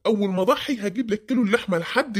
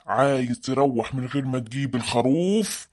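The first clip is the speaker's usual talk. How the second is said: ghost voice; 8 semitones lower than usual. Acted. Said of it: "وأقلد صوت العفريت".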